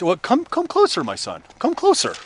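put-on high, soft old dad voice dropping on "my son"; beckoning old father. Father calling disguised son closer, high and coaxing. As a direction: coax your son nearer, pitch swooping up.

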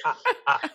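high vampire "ah ah ah"; Count laugh. Pretend. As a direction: cackle like the Count.

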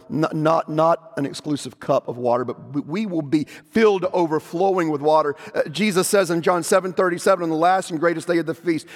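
ordinary delivery

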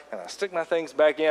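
normal talking voice

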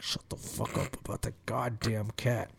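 low, hushed irritation; pissed. Retold or imitated. Getting pissed as Elisa pets the cat, quietly irritated. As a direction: re-enact being pissed under the breath. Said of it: "And I'm getting pissed".

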